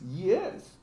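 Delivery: usual speaking voice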